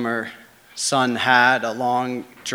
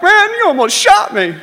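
much higher and louder shaken cry; startled yell; 6 dB louder than usual. Retold or imitated. Barrett jumping from the truck after the gunshot, startled yell. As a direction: yelp it in shock.

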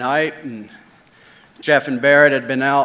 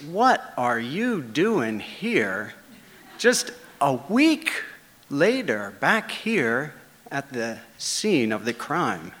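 a quiet, slowed inner voice, half to himself; inner thought voice; 7 dB quieter, slower than usual. Retold or imitated. Seeing Linda back at the mill, thinking it quietly and slowly. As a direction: muse it softly, like a suspicious thought. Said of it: "what I immediately thought when I saw her was".

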